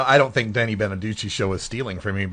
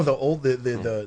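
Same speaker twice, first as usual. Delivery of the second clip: ordinary talk, nothing marked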